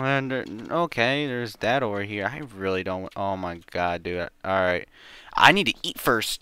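ordinary talk

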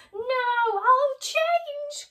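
very high desperate cry. Acted. Scrooge crying out over his grave, high and loud. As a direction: shriek a promise. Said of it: "cried Scrooge".

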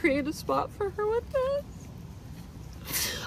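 high, slow, soft tearful voice; choked up. Real, not acted. Planning a spot for cremated Lily, choked up. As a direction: push through the words as the voice gives way.

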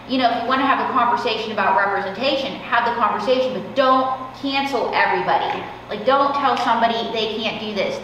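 usual speaking voice

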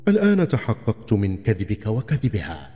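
usual speaking voice

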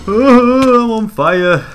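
high, loud startled cry; alarmed yelp. Real, not acted. Gamer suddenly on fire in the game, alarmed. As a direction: burst out loud and high, falling as it goes.

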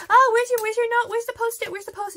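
high, loud, hurried questions; frantic rushed asking. Retold or imitated. Fan hurrying the idol for his note, frantic and high. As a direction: rattle it off in a last-minute panic. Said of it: "very last minute of just like".

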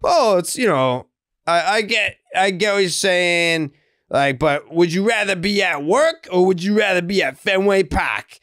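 high, exaggerated mock Boston fan voice; mocking Sox fan. Mocking fans who skip work for Fenway, high mock voice. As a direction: ham up a Fenway-loving fan. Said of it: "I get all the people that are like".

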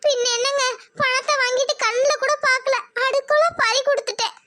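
lamenting wife. Acted. Wife lamenting lost money to husband.

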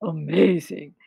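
low, impressed monster voice; deep-pitched storybook creature; Gruffalo. Acted. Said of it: "said the Gruffalo".

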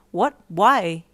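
loud, puzzled questions; confused. Acted. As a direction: ask it as if completely lost. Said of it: "you asked, confused".

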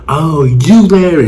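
slow dawning surprise; surprised realization. Retold or imitated. Guy realizing who Larry is, slow surprise.

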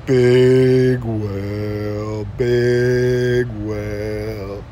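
long, droning held vowels; dad's whale call. Acted. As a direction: stretch each word out on one steady note. Dad's answer to the chant.

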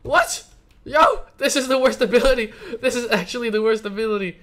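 loud, high disbelieving yell; shocked outburst. Real, not acted. Ability breaks, shocked outburst.

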